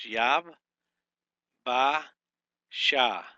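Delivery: Yab, Ba, Sha as three separate loud beats; syllable by syllable. Shown as a demonstration. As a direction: tap out each syllable of Yabashah. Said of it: "So this is three syllables".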